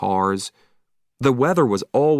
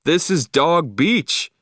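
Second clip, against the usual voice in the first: insists this is Dog Beach, high and drawn out; Killer's owner insists loudly it is Dog Beach, high, slow calling voice; raised voice, high and slow; 7 semitones higher, 3 dB louder, slower than usual. A narrator's reading. Dog owner protesting, higher and slow. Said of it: "he shouted".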